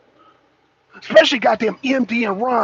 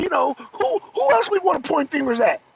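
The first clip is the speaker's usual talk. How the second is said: usual speaking voice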